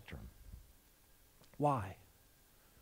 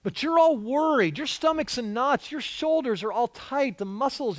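ordinary talk, nothing marked

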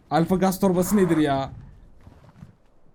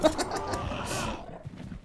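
breathy laugh; 13 semitones higher, 7 dB quieter than usual. Real, not acted. Laughs at his own mistake.

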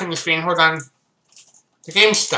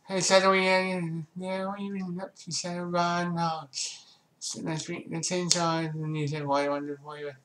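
quiet; 13 dB quieter than usual. Real, not acted. Quiet aside reading chat.

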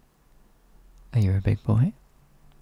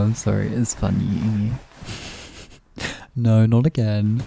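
ordinary talk

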